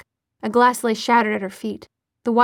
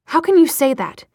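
louder, higher challenge; indignant demand; 5 semitones higher, 6 dB louder than usual. A narrator's reading. Jace challenging Clary over Sebastian, demanding. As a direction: demand it in a raised voice. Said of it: "Jace demanded".

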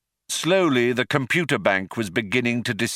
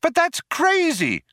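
much higher, slow outcry; Trillian crying out. A narrator's reading. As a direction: cry out in disbelief. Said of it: "cried Trillian".

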